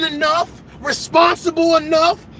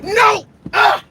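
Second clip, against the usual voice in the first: high, loud rejection; emphatic dismissal; sharp no; 7 semitones higher, 3 dB louder than usual. Real, not acted. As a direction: cut them off hard.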